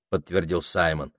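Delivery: ordinary delivery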